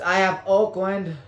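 normal talking voice

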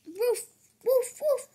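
high playful woofs; puppy bark. Acted. Boy barking as Biscuit while reading. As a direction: yip like a little puppy.

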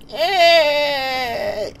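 put-on high, slow sleepy old-timer; drowsy old person calling out; very old faint voice. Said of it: "this old person voice".

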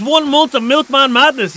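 slow, drawn-out hype reading; hype announcer read. Acted. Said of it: "He says".

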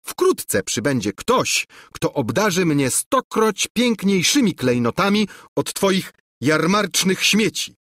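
spoiled princess mocking his cheap gifts; haughty princess. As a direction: brag to wound him, pitched high. Ungrateful princess boasting.

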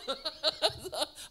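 loud, high burst of laughter; real laughter. Real, not acted. Preacher amused by his off-key demo, loud laughter. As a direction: crack up after your own bad singing. Laughs at own bad singing.

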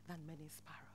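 whisper. Acted. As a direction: drop to a breathless whisper on sparrows.

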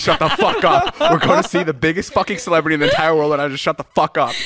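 very high mock shout through laughter; laughing mock yell. To nervous Natalie in the car, a very high laughing mock yell. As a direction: yell at her while cracking up.

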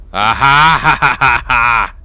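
loud gloating ha ha ha; triumphant laugh. Real, not acted. Gamer catching the enemy who crept up, loud gloating laugh. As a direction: cackle in victory over the enemy.

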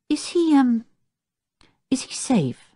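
hesitant, doubtful question with pauses. A narrator's reading. Dick asks about the big chimpanzee, doubtfully. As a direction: ask warily, unsure of the answer. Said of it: "eyeing the big animal doubtfully".

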